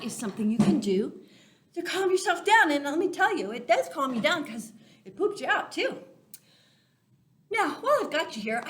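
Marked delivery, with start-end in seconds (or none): none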